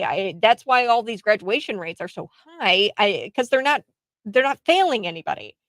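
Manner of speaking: ordinary delivery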